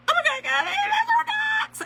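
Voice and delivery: high, loud alarmed shriek; shocked cry. Retold or imitated. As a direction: yelp it out in sudden panic. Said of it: "I said".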